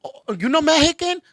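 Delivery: high, slow, disbelieving questions; surprised stranger. Retold or imitated. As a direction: act baffled at her. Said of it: "Oh, you don't speak Spanish".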